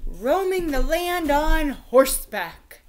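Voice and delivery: slow, grand fatherly king; king's suggestion. A narrator's reading. King urging his son toward knighthood, slow and grand. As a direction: savour the words like a proud old king. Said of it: "the king suggested".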